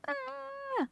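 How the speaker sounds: sudden high squeal of a bratty egg; tantrum shriek. Acted. Reading to kids, mimics an egg's tantrum with a squeal.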